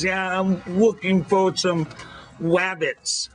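put-on high, slow little-kid voice with a lisp; little girl voice. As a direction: sound like a small girl who can't say her r's. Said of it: "she says".

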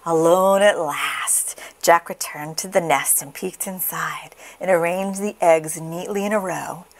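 usual speaking voice

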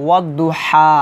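slow "ওয়াদুহা" with a long "হা"; "হা" drawn out at the stop; one-alif stretch. Shown as a demonstration. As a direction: hold the end of "ওয়াদুহা" open. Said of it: "এখানে আপনার এক আলিফ টান".

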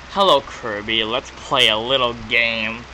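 low game villain voice; low, creepy invitation. Acted. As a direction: read it as a menacing game villain. Reads game villain's text aloud.

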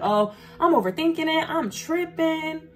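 high, drawn-out mock self-doubt. Voices listener doubting their own suspicion.

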